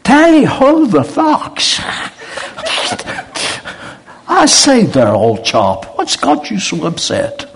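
put-on very high, loud British accent, slow on tally ho; the returned Texan gushing in a hammed-up British voice; over-the-top British accent. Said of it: "And he goes".

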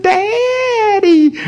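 tiny, very high squeak of a daughter; little mouse voice. Retold or imitated. Daughter calling at Norval's bedroom door, imitated mouse voice. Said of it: "her voice sounded like a little mouse".

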